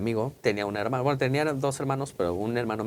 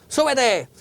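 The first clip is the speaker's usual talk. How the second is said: a sharp order, higher and louder; firm order; 10 semitones higher, 5 dB louder than usual. Retold or imitated. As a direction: tell the kid to get upstairs, loud. Manda subir al hermano. (orden tajante, más aguda y más fuerte)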